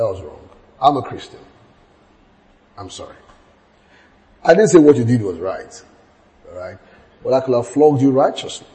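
low, soft and gentle; tender apology. Retold or imitated. Father apologising softly to his daughter after flogging her. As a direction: speak low, as if making peace.

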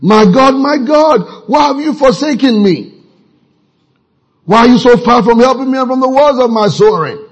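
crying out. Acted. David crying to God in Psalm twenty-two, acted high cry. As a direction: call out the psalm as a plea to God.

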